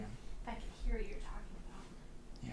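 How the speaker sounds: sneaking whisper. Real, not acted. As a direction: whisper it so no one hears. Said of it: "sneak back in".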